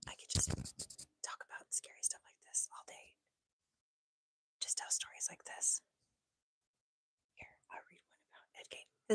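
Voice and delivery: whispered scary storytelling voice; spooky whisper. Shown as a demonstration. Showing viewers a scary story voice, whispered. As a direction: tell it in a hushed whisper. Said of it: "does it just sound like I'm whispering".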